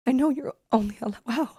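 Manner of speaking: breaking off, choked with tears. Real, not acted. Guest breaking down telling the hospital story, choked up. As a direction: try to speak but the tears stop you. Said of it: "Take your time".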